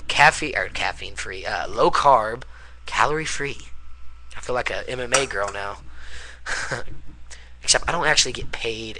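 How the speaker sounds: ordinary talk, nothing marked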